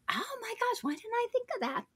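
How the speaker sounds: high, soft mock wonder; amazed realization. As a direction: suddenly marvel in a small, high voice. Acted homeowner seeing the vision.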